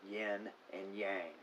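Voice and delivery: "yin and yang" said as YEN and YANG, rhyming with hang; drawn-out "yin and yang" with yang rhyming with bang; common American pronunciation. Shown as a demonstration. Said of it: "This is how it is often pronounced".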